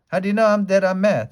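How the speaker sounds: high, loud startled protest; Alice hurt and taken aback at being called mad; indignant surprise. A narrator's reading.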